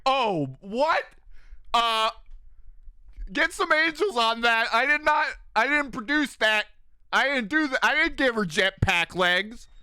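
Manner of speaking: high, loud baffled God voice; a hammed-up deity disowning it; mock flustered God. Streamer voicing God puzzled by Lilith flying off, high and loud.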